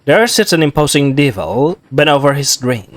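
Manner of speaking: normal talking voice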